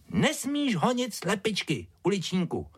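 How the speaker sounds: put-on high-pitched little girl telling off her dog; Ája scolding. A narrator's reading. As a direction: lecture in a high child voice. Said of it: "kázla mu".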